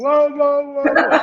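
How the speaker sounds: high, loud garbled wailing; imitated heckler. Woman in the audience heard by the singer, imitated heckler. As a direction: mimic the woman's whoa-whoa. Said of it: "I hear this woman".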